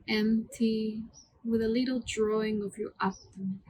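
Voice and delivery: calm, hushed meditation voice with long pauses; guided meditation. Acted. Yoga teacher leading students through mantra breathing, soothing guided meditation. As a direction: guide the breath gently, word by unhurried word.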